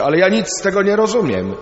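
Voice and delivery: a pupil shouting in class that he understands nothing; boy shouting. Retold or imitated. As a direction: blurt it out like a confused schoolboy. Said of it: "jeden nagle krzyczy".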